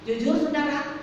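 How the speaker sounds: ordinary delivery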